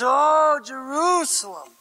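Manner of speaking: acted sorrow, lingering on the opening cry; weeping lament. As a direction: lament over a city, without real tears. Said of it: "He weeps".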